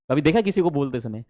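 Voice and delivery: normal talking voice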